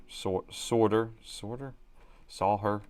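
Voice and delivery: solder sounded out slowly, like a question; trying to say solder. Shown as a demonstration. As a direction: grope for how solder should sound. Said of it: "I can't say it still".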